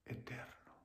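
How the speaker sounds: whisper. Real, not acted. Preacher whispers eterno to the congregation. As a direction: let eterno fade into a whisper.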